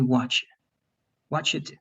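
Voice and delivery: "what you" squeezed into "whatcha"; most contracted. Shown as a demonstration. Teacher showing the shortest casual form, most contracted. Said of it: "That's the most contracted way".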